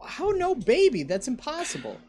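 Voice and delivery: high disbelieving squeal; shocked it's not there; surprised. Real, not acted. Surprised no game found.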